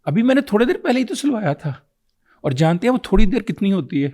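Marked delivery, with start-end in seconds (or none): 0.1-1.7 quicker, higher retort as the father; lightly acted dad brushing off the question; father's defensive reply. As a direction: toss it back fast, pitched up. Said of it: "تو آگے سے جواب ملتا ہے".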